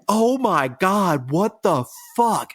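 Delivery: high, drawn-out mock horror; retold shock. Wife walks in on bleeding husband at the sink, shocked. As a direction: stretch it out high, aghast at the sight.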